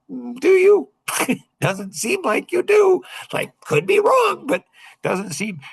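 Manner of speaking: very high, sing-song skeptical voice; mock doubt. Answering kids who claim they understand, high mock doubt. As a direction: doubt them in a squeaky, drawn-out falsetto.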